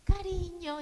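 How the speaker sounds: high, syrupy pity on "cariño". Retold or imitated. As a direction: coo it like you feel sorry for her. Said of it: "ese tonito de".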